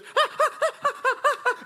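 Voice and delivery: rapid, high, rhythmic mock panic noises; hammed-up frantic little gasps; imitated panicked panting. Sister about to flee out back door, mock panic.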